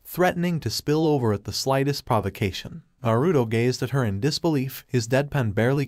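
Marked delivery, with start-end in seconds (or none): none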